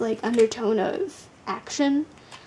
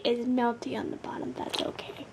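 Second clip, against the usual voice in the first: whisper; 8 dB quieter than usual. Real, not acted. Whispered aside about jar.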